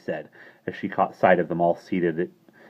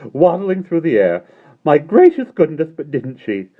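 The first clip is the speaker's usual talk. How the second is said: put-on high, loud giggly old man; Mister Wig's voice; 10 semitones higher, 9 dB louder than usual. A narrator's reading. Mister Wig to Mary, half amused. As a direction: scold her while trying not to laugh.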